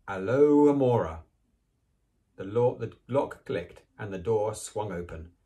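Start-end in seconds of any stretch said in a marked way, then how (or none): none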